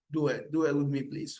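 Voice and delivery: ordinary talk, nothing marked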